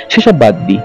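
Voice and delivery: ordinary delivery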